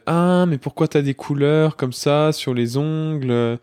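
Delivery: slow, drawn-out mock child taunts; imitating teasing pupils. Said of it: "et puis ils m'ont dit".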